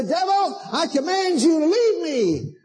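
high, slow, stern rebuke; authoritative command; commanding authority. Shown as a demonstration. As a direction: order the devil out. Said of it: "rise up with authority in Jesus' name and say".

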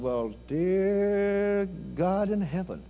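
slow, drawn-out gasp of amazement; awed exclamation. Retold or imitated. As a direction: marvel at the gift slowly. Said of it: "I said".

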